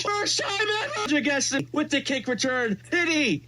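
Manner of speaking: high excited squeal; overjoyed high-pitched yelling; shrieking jackpot winner. Real, not acted. Still shrieking over the spin.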